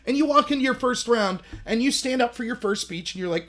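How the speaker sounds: usual speaking voice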